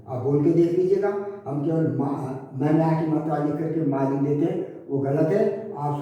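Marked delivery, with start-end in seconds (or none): none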